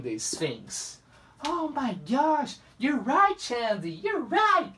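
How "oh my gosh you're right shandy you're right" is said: higher, excited made-up fan voice; pretend viewer. An imagined viewer praising Shandy, in a high excited voice. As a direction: play an amazed fan cheering her on.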